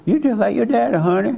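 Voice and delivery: a playful honeyed jab at her husband; sweet teasing. Shown as a demonstration. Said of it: "See that?".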